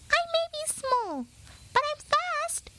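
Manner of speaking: high, firm mouse voice; insistent little mouse. A narrator's reading. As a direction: insist bravely, squeaky. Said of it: "insisted Pip".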